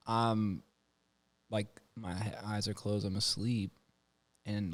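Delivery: usual speaking voice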